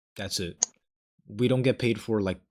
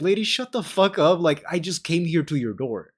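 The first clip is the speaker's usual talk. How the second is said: high, loud snap at her; angry outburst; 7 semitones higher, 6 dB louder than usual. Pretend. Salesman snapping back at angry lady, loud and high. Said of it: "I'll be like".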